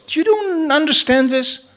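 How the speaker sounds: high, incredulous wife; wife's voice. Retold or imitated. Wife to puzzled husband, high and incredulous. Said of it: "And she goes".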